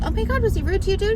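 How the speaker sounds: high, loud, rushed question; excited commiseration. Retold or imitated. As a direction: blurt it out to a fellow victim. Retold eager question to fellow shopper.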